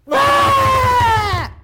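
put-on shrieking high child's tantrum scream. A spoiled child in the grocery store, tantrum scream.

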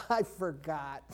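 low, soft, drawn-out mock excuse; feeble excuse. Excuse for missing church on Sunday, low and feeble. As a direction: sink into it slowly and softly.

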